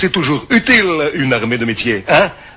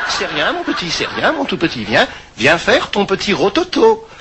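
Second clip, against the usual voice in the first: put-on cooing to a baby; 3 semitones higher than usual. Grandma soothes the baby.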